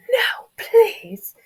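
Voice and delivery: loud, very high squeaky whine; pleading child. Acted. Little brother begging bullies, high frightened plea. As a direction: beg in a tiny, strained child's voice.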